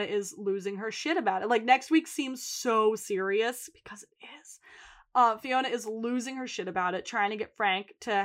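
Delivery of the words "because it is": hushed, voiceless whisper; whispered aside. Real, not acted. Host admitting next week is serious, whispered aside. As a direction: drop to a near-silent whisper.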